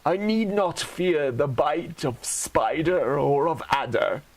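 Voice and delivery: normal talking voice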